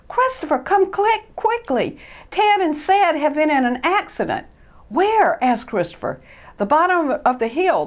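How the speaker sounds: high, loud breathless news; urgent alarm. Acted. A friend running up to report the crash. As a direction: rush to raise the alarm.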